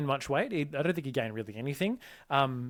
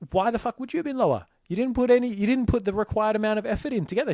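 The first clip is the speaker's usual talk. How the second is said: higher and louder, hammering the frustration; exasperated rant; 7 semitones higher, 5 dB louder than usual. Real, not acted. Coach venting about a client who didn't put in effort, exasperated and loud. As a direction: let it burst out, incredulous.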